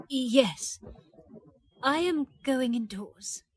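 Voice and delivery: usual speaking voice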